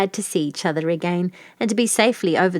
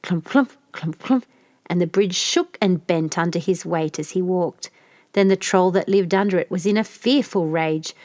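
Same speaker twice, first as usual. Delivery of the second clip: plain reading voice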